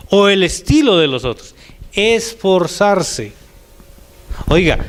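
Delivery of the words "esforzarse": "Esforzarse" said very slowly, syllable by syllable; a slow, weighty "Esforzarse"; stressed word. Shown as a demonstration. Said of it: "me gusta más la otra palabrita".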